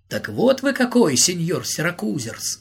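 usual speaking voice

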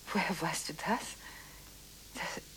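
breathless whisper of disbelief; shocked whisper. Acted. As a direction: whisper it as if stunned. Phone call, shocked whisper.

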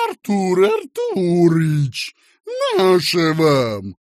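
loud, high, drawn-out fawning; sugary greeting. A narrator's reading. Boss fawning on young visitor, sugary greeting. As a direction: gush a greeting to a superior. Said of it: "вскричал он сладко".

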